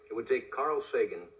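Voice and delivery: ordinary delivery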